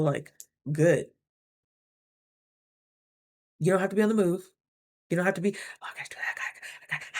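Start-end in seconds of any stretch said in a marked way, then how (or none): 5.8-7.1 breathless, hurried whisper; frantic whisper. Retold or imitated. Mimicking her restless self, a panting whisper. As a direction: whisper it like a panting anxious dog. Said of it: "panting like a goddamn dog".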